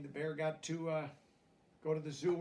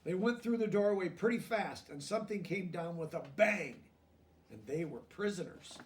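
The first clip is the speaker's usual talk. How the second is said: gentle storybook reading, a touch higher; lively picture-book narration; reading to children; 5 semitones higher, 8 dB louder than usual. A narrator's reading. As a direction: read it like a bedtime story to kids.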